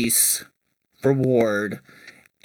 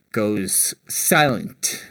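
ordinary talk, nothing marked

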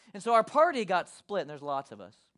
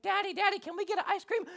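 put-on high, small child voice; imitated pleading kid; 12 semitones higher than usual. Children ask distracted dad for a treat, imitated pleading kid. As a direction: play an eager kid pestering Dad.

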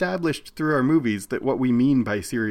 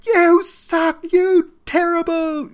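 put-on high, loud kid yelling abuse from the crowd; shouted heckle; 6 dB louder, slower than usual. Kids in the hockey crowd shouting at players, high loud heckle. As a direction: heckle at full pitch, drawing out the insult. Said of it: "are shouting out, like".

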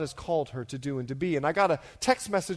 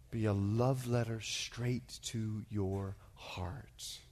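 slow, hushed and low; gentle, intimate appeal; tender; 6 semitones lower, 7 dB quieter, slower than usual. Real, not acted. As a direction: draw it out softly, almost whispering. Tender appeal before reading letter.

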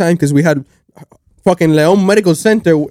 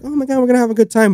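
mock excited aunts; 6 semitones higher, 4 dB quieter, faster than usual. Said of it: "they were like".

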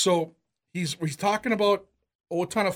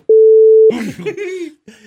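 big, high, loud burst of laughter; 13 semitones higher, 16 dB louder than usual. Real, not acted. Cracking up while telling his friend the story. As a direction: let a real laugh explode out.

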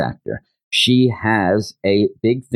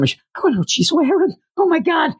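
very high, fast fretting; hammed-up high-pitched fluster; mock flustered squeal; faster than usual. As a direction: squeak it out in comic excitement. Flustered boy acted, yelling scene.

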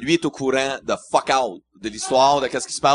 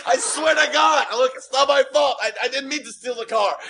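panicked pleading; 8 semitones higher than usual. Retold or imitated. Driver begs the armed guard, panicking. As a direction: plead for your life, half-laughing. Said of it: "Là, je panique".

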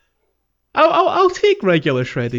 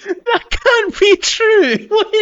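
laughing, much higher and louder, slow; can't believe it, laugh through it; laughing disbelief; 9 dB louder, much slower than usual. Real, not acted. Disbelief at the cornflakes claim.

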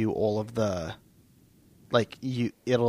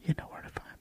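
creepy whisper; faster than usual. Pretend. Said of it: "You're creeping people out".

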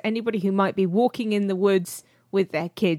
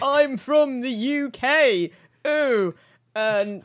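hammed-up, slow, higher British accent; drawn-out caricature of an English accent, raised in pitch and volume; mock English accent; 5 semitones higher, 5 dB louder, much slower than usual. Showing how Americans imitate Brits, in a mock English accent. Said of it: "imitate English accents when they're over here".

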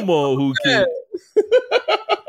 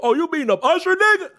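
heckler in a video; 11 semitones higher, faster than usual. Retold or imitated. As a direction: taunt him like a heckler, pitched way up. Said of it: "There was a poster that posted a video".

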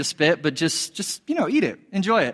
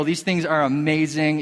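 ordinary talk

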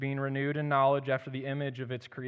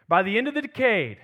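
put-on raised, louder Irish-American accent for Kennedy; 6 semitones higher, 6 dB louder than usual. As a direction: quote the president in an Irish accent. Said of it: "in his Irish accent".

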